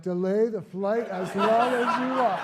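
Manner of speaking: gleeful. Retold or imitated. Thrilled first-class passenger replying.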